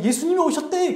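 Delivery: high-pitched, excited news to a sibling; breathless-messenger urgency, acted out; excited announcement. Maria runs to tell sibling.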